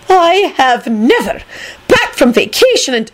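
high, indignant tirade; wrathful shout. A narrator's reading. McGonagall ranting about a marauder, wrathful shout. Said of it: "McGonagall shouted wrathfully".

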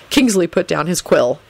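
plain reading voice

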